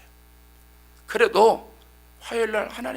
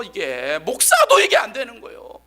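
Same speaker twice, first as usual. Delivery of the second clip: preaching emphasis; 3 semitones higher, 9 dB louder than usual. Real, not acted.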